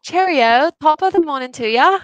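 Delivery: put-on British greeting voice; British accent. Shown as a demonstration. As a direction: try a cheery amateur British accent. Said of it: "can I do a British accent?".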